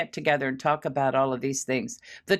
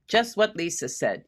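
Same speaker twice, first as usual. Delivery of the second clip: ordinary delivery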